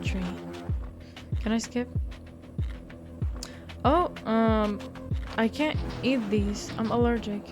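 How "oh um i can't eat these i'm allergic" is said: ordinary talk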